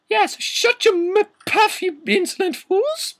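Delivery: very high falsetto scolding; Professor McGoggle, yelling. Acted. Professor yelling at insolent students, falsetto screech. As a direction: screech like a hammed-up schoolmistress. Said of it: "yelled Professor McGoggle".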